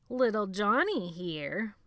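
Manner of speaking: slow, loud, drawn-out relish; savoring taunt. A narrator's reading. Benny taunting Jonathan, slow and relishing. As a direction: relish every word. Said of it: "savoring every bloody word".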